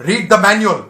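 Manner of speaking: loud, curt captain's brush-off; a loud, dismissive captain's reply; curt retort. Retold or imitated. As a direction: shut the cadet down. Captain snaps back at cadet.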